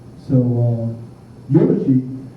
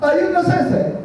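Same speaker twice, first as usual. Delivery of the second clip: high, polite question; two big guys asking the priest at his door, high; big Samoan guy; 13 semitones higher than usual. Retold or imitated. Samoan guys asking the sensei, high and polite. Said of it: "they come to my room and they said".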